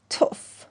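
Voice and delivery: short voiceless spit, "tfu"; spitting. Acted. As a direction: spit in disgust. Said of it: "اینطور بی تر و توف می اندازید". (صدای تف انداختن)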